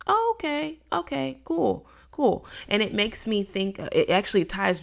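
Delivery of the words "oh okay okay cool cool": very high, sing-song sarcastic okays that fall away; drawn-out mock agreement, eyes rolling. Preacher mocking the complaining Israelites, high and sarcastic.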